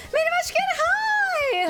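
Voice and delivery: high-pitched squealed hello; excited greeting. Real, not acted. Excited greeting to arriving chat friend.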